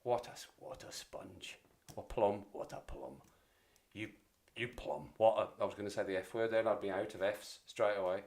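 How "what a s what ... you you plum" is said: put-on Scottish accent. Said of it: "in the Scottish accent, it's even better".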